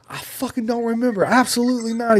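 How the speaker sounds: high, loud panicked refusal; scared jumper refusing at the door. Retold or imitated. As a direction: shout no in terror. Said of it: "I was like".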